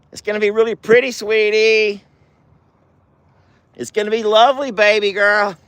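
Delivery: high-pitched sweet pet talk; cooing praise. Real, not acted. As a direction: gush sweetly, as to a beloved pet.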